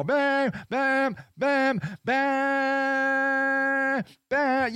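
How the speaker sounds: mock horn honking; imitated horn blasts. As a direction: bark out "bam" as a horn. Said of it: "so I go".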